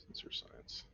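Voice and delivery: whisper. Real, not acted. Quiet aside at the table.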